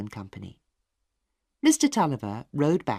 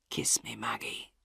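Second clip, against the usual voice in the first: much lower, soft, breathy last whisper; 11 dB quieter than usual. A narrator's reading. Dying father asking Maggie for a kiss, faint whisper. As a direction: barely breathe the words.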